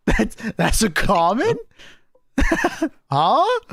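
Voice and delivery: slow, laughing question; laughing disbelief. Real, not acted. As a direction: laugh through the words. Laughing at overpowered item.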